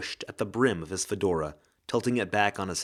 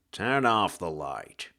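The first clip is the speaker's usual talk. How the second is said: lower, slow tough-guy detective; hard-boiled ghost; 4 semitones lower, slower than usual. Acted. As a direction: drawl it like a noir gumshoe.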